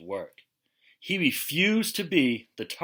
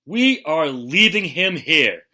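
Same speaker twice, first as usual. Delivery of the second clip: louder angry declaration; bellowing; 4 semitones higher, 9 dB louder than usual. Acted. Father announcing they abandon Ralph, loud. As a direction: boom out the decision. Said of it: "bellowed father".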